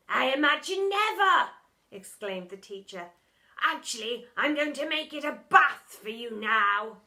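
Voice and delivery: a hint of a sneering schoolmaster; stern teacher. A narrator's reading. Teacher gloating at the boy, lightly pompous.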